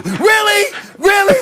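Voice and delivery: incredulous. Real, not acted. Incredulous repeat.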